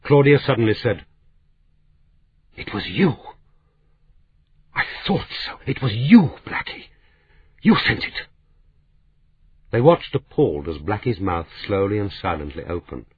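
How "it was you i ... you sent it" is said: accusing outburst. Acted. Claudia suddenly accusing Blackie of sending the fax, outburst. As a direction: point the finger and nail her.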